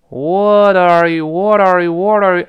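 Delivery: "what are you" repeated, from drawn-out to quick, linked; speeding up drill. Shown as a demonstration. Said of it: "就这么一个动作".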